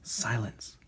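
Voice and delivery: plain reading voice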